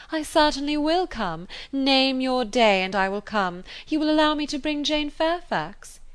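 a hint of a pushy patroness; self-important lady voice; lightly voiced eager lady. A narrator's reading. Voices Mrs Elton throughout.

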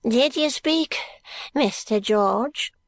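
put-on high, slow old man's query; old Smallweed inquiring. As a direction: ask it in a thin old man's voice.

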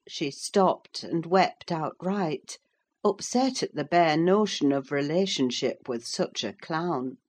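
ordinary delivery

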